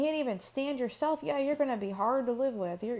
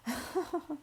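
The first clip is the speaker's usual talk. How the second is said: short laugh; 5 semitones higher, 5 dB quieter than usual. Real, not acted. Laughs at own comment.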